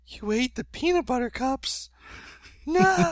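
high, loud squeal of betrayal; a small kid's shocked, rising wail; little brother shrieks over stolen candy; outraged little boy. Retold or imitated.

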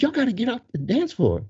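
high, insistent imitated command; retold bouncer's order. Employee clearing two men off the floor, high insistence.

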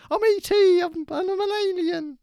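very high, loud squeaky alien voice; high-pitched alien impression. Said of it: "That's how E T speaks".

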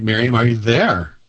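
usual speaking voice

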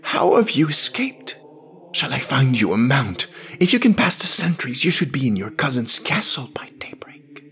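high, pressing priest's voice; urgent questioning. Acted. Priest offers the escaped woman help, urgent questioning. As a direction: urge it high, hurrying through the middle. Priest urging her to flee.

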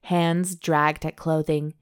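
steady read-aloud storytelling voice; romance story narration. A narrator's reading. Host reading a steamy novel to her cohost, narration voice. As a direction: read the love scene out like an audiobook.

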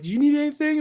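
chirpy high offer of help; sweet high helper voice. Retold or imitated. Cheerful enforcer asking the friends if they need anything, high and sweet.

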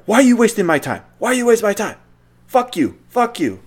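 lightly voiced caller losing his temper; a scammer who has been strung along for thirty minutes, mock yelling; mock angry scammer. Scammer angry after realizing.

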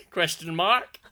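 usual speaking voice

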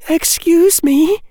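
put-on high, slow girlish interjection; politely insist, drawing it out in a high voice; polite but firm. Hannah interrupting the others, polite but firm. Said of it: "in a tone that was polite but firm".

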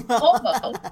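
teasing, laughing. Real, not acted. Teasing about near fall.

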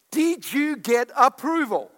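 loud, ringing sermon voice; fiery pulpit preaching; preaching at full force. Acted. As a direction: preach it out to the whole church.

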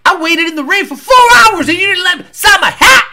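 high, loud acted shout; unhinged fan outburst. Psycho fan who waited hours in rain, acted screaming outburst. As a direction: scream it like a crazed rejected fan. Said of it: "that guy's gonna go".

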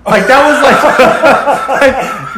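remembering the brother's reply; laughing. Real, not acted. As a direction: laugh through it. Laughing at memory.

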